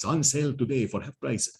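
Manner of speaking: lightly voiced cheesy ad announcer; hint of a huckster selling cheap warheads; mock sales pitch. Lecturer mocking the enemy's bargain warheads, mock ad voice.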